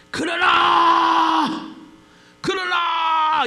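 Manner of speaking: long, high, drawn-out cry; preacher's shout. Real, not acted. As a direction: shout the word out and hold it. Preacher cries out the key word.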